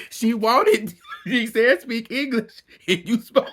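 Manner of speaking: words broken by laughter; retelling while laughing; laughing through words. Real, not acted. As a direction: keep laughing as you tell it.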